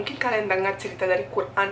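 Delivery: ordinary talk, nothing marked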